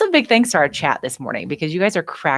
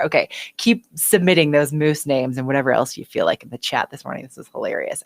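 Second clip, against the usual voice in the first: ordinary talk, nothing marked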